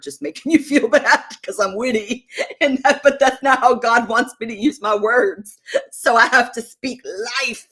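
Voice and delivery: amused self-mockery through laughs; laughing. Real, not acted. Laughing at her own wit.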